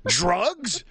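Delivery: high, exaggerated mock-naive exclamation; mock loud blurt. Clueless dad asking the dealer too loudly, mock blurt. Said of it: "And I said it, like, really loud".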